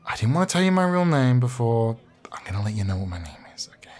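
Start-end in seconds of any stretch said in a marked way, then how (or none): 2.3-3.9 fast whisper of a secret. Acted. Confiding his real name to the visitor, a fast whisper. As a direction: lean in and whisper it.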